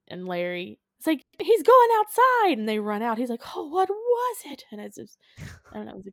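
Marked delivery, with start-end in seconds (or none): 1.4-2.6 loud, high, drawn-out cry; mock shriek. On-screen alarm as the monster leaves, mock shriek. 3.6-4.7 high-pitched, put-on dismay; mock dramatic cry. People run out after the monster, mock dramatic cry.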